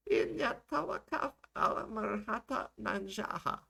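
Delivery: very high, slow rant; ranting older woman. Acted. Older woman rants at the camera, high and drawn out. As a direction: pitch it way up as an impassioned old woman. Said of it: "the older woman continued to rant".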